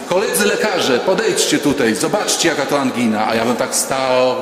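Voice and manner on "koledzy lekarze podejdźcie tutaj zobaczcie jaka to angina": mock amazed summons. Doctor calls colleagues to see the priest's throat, mock amazed. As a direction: play an awestruck doctor calling others over.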